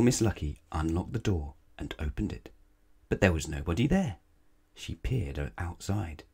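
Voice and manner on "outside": usual speaking voice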